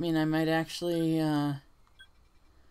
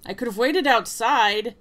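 high character voice; 7 semitones higher, 6 dB louder than usual. Acted. Character line voiced in game.

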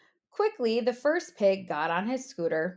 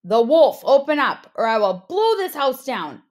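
loud demanding wolf; wolf's threat; 2 semitones higher, 7 dB louder than usual. Acted. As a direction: bellow the threat at the door. Wolf threatens brick house.

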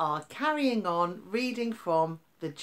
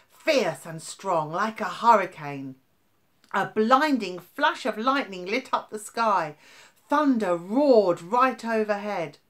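louder, higher, building storm telling; dramatic storm narration; 3 semitones higher, 8 dB louder than usual. A narrator's reading. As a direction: make the storm roar as you read.

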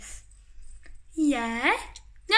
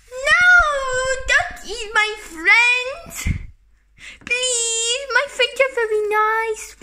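pleading; 7 semitones higher than usual. Acted. Pleading with villain.

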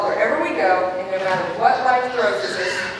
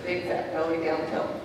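quiet recital; 6 semitones lower, 7 dB quieter than usual. Shown as a demonstration. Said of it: "That's a capital R".

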